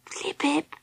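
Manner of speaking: high frightened whisper; small, soft, fearful call; quiet, scared voice. A narrator's reading. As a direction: whisper timidly like a scared little one. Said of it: "said in a quiet, scared voice".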